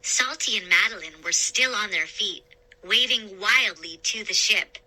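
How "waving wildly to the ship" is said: ordinary delivery, nothing marked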